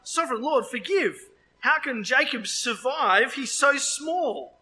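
high, drawn-out plea to God; impassioned high appeal read from scripture; crying out in plea. A narrator's reading. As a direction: cry out for mercy, slow on the last words. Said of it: "I cried out".

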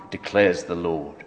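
ordinary talk, nothing marked

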